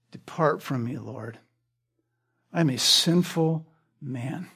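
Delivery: hushed awe. Retold or imitated. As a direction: breathe it out in humbled awe. Peter overwhelmed in Christ's presence.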